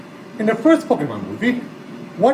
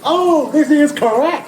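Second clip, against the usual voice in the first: delighted shout, pitched up; excited cheer; 7 semitones higher than usual. Real, not acted. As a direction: cry out happily that it's right. Mom cheers the right answer.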